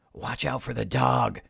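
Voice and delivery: put-on rough crook voice; burglar. A narrator's reading. Burglar warning his partner about the dog, put-on crook voice. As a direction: warn your partner in a burglar's character voice.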